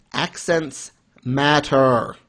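Accents matter said very slowly, word by word; slow, deliberate weight on Accents and matter; emphatic declaration. Real, not acted. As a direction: lay down Accents matter as a rule. Mock-stern closing lesson to listeners.

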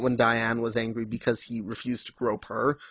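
usual speaking voice

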